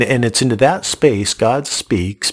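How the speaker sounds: ordinary delivery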